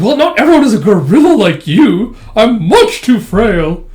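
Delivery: high, loud mock protest; indignant complaint. Retort to Yor about being too frail, indignant. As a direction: complain in a hammed-up, put-upon way.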